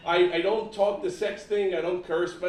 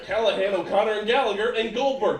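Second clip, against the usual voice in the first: ordinary talk, nothing marked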